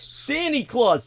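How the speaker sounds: high, slow Brooklyn Santa Claus; Brooklyn accent. Shown as a demonstration. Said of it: "with that Brooklyn accent".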